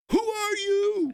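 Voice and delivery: put-on high, slow, loud callback to the lost toddler's line, laughing; mock little boy. Father walks up to his champion son and teases him, high, slow, laughing child voice. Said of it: "took the time to walk up to him and go".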